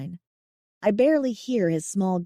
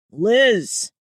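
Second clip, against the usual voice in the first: loud call across the water; bellowing; 7 dB louder than usual. A narrator's reading. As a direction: bellow the name. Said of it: "a familiar voice bellows".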